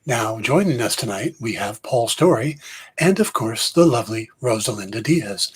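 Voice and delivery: golf commentator. Pretend. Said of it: "I'm done with the golf voice".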